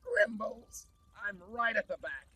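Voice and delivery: Grandmaster Glitch as a gleeful, scheming villain; smug, showy villain eager to party. Acted. Villain Glitch boasting to his Grimbots, gleeful.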